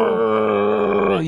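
low growled arrgh of pain; ogre pain roar. Acted. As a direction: roar like an ogre scraping his skin raw. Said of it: "He's literally gonna be like".

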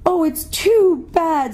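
high, slow, tearful wail; acted child's lament; sobbing girl. A narrator's reading. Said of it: "sobbed Lucy".

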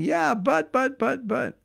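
high-pitched stammered objection, sinking as it goes; mock a devotee sputtering objections; protesting. Devotee arguing back at Krishna, high protesting. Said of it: "and you're going".

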